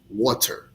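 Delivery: "water" said carefully with a clear t; proper English pronunciation. Shown as a demonstration. Teacher showing listeners proper English, carefully pronounced "water". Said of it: "is the proper way".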